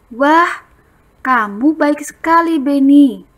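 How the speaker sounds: emphasize the compliment; praise expression. Shown as a demonstration. Teacher highlighting the praise phrase. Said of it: "ungkapan pujian".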